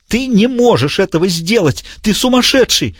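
very high, frantic madman near a howl; panicked villain. A narrator's reading. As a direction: break into hysteria. Diogenes voiced high.

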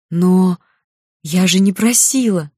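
surprised protest, a drawn "но", then a burst; astonished exclamation. A narrator's reading. Girl to the magic cupboard over an unasked gift, exclaiming. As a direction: exclaim it in mild amazement. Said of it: "воскликнула девушка".

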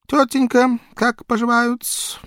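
high, fawning barber; obsequious barber. Acted. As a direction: ask like a servile barber. Barber asks politely.

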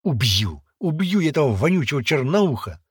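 loud, mounting rage; furious vow. A narrator's reading. Boy vowing revenge for the girl, furious. Boy punches wall, vows to kill.

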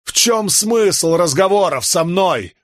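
high, drawn-out shout to the heavens. A narrator's reading. Man shouts at the sky, demanding a reason. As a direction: yell it up at the sky. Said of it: "прокричал Халин".

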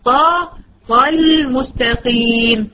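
طا then طل said very slowly, broken apart; slow, split-up practice reading; syllable by syllable. Shown as a demonstration. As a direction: chop the word slowly into pieces. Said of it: "هقطع كده عشان أتعلم أقول".

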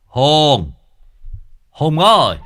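loud call into the house. Acted. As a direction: call Hùng from the gate. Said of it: "gọi vọng vào trong". (gọi to vọng vào nhà)

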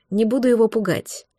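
ordinary delivery

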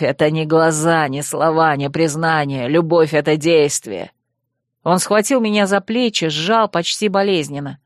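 низкий, вибрирующий голос оборотня-парня, обиженный; сыграй мрачного Вервульфа с упрёком; оборотень Койл. Acted. Койл убеждает Найку. (низкий вибрирующий голос оборотня с упрёком)

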